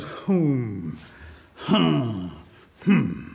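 low, drawn-out hums with pauses; pondering hums. A narrator's reading. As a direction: hum and huh low, mulling it over. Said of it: "Rabbit goes".

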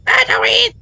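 high excited squeal. Acted. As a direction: squeal with joy. Echoing excited find.

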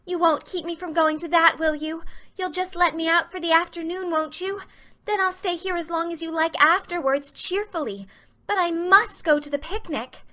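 put-on an eager girl begging to be let out. A narrator's reading. Anne begging Marilla to let her go to the picnic, high pleading.

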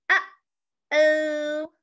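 a small bird voice, worried. Acted. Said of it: "The bird says".

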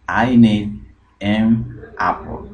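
slow teaching demo; slow model line. Shown as a demonstration. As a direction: drag it out for students. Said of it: "slow model repetition".

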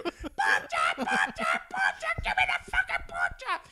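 very high, loud cartoonish taunt; hammed-up, shouted parroting; mocking mimicry. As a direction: squawk it like a ridiculous imitation of a customer. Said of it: "this is you, this is you".

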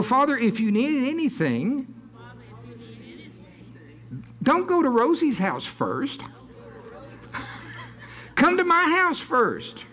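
high, eager plea to be picked; eager volunteering. Acted. Steward offering the house to the Lord, eagerly.

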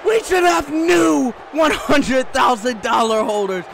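insistent complaint in a normal register; firm protest. Real, not acted. Commentator repeats his complaint about the title holders, firmly. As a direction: state the grievance forcefully without screaming.

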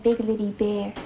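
dropped low and casual; low pet name. Acted. Girlfriend naming boyfriend to his sister, low pet name. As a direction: say it down in your low register.

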